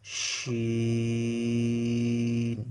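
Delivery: শিন held long with a steady sh sound; drawn-out shin. Shown as a demonstration. Teacher showing students the letter শিন, drawn out. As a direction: stretch শিন slowly. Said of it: "আমার সাথে সাথে বলে শিখে নিতে হবে".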